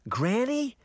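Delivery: high, rising question; small surprised query; puzzled little sister. A narrator's reading. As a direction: ask it in high-pitched surprise. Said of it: "Daphne asked".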